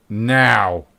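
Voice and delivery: loud, insistent one-word order; forceful, allowing no delay; stern command. Acted. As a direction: cut off the excuse with a firm demand. Reese refuses to wait.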